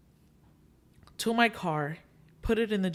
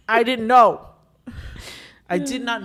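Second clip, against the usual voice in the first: much louder, emphatic outburst; loud exclamation; 5 semitones higher, 10 dB louder than usual. Real, not acted. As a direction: blurt it out loud in disbelief. Exclaiming her ignorance.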